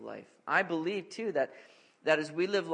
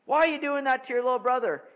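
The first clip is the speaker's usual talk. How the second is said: higher, louder scolding; upset dad; 9 semitones higher, 9 dB louder than usual. Retold or imitated. Dad confronting a kid about the little brother, loud scolding. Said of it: "I'll go over and I'll say".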